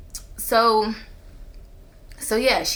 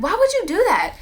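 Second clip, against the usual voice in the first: much higher, louder disbelieving why; shocked outrage; 12 semitones higher, 5 dB louder than usual. Retold or imitated. Confronting the friend about her text, shocked and loud. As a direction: demand an answer in stunned outrage.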